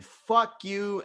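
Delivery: retold outburst, not shouted; imitating her cussing out. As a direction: toss out her angry insult. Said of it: "cussing me out of her house".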